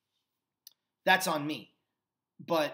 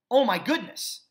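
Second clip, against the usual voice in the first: exclaiming; 6 semitones higher, 8 dB louder than usual. Real, not acted. Exclaiming at a three-hour show.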